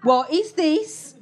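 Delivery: high amused query, slow and giggly; laughing, high and slow. Retold or imitated. Waving a gun at David, laughing and high. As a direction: wave it about laughing like a treat was found. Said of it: "Like I'd found a hidden packet".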